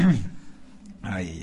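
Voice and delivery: ordinary delivery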